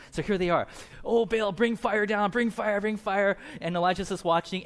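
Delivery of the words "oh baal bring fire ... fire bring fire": higher, slow mock wailing prayer; imploring prayer. Prophets calling on Baal for fire, mock-imploring. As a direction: chant it like a desperate, hammed-up plea. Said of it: "they start praying".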